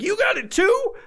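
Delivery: very high squeaky disbelief; squeaky high shocked question; 1 shrill disbelief. Pretend. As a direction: squeak it in shock at your friend. Seeing friend's antlers.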